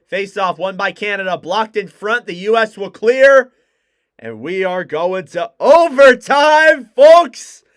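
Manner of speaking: hockey call building to loud shouts; live play-by-play. Real, not acted. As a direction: call the rush as it happens, rising to a roar. Play-by-play of the last faceoff.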